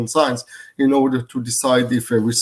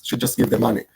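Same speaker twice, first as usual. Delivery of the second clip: usual speaking voice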